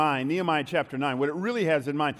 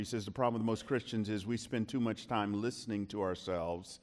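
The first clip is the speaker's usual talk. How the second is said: ordinary delivery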